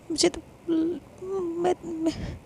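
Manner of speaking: choked, tearful broken words; fighting back tears; crying. Acted. Sister breaks down crying over the forced wedding.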